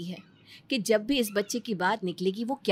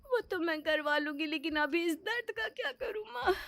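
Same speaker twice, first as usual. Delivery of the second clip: tearful pain; 9 semitones higher, 3 dB quieter than usual. Acted. Daughter still in pain.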